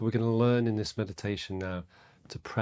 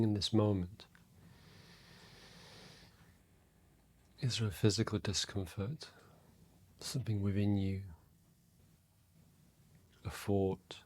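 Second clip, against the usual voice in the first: guided meditation; 6 dB quieter than usual. Real, not acted. Meditation teacher to listeners settling into their breath, soft and calm. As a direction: guide them inward quietly, leaving space between phrases.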